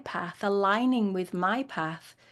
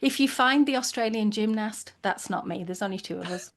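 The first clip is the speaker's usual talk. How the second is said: ordinary talk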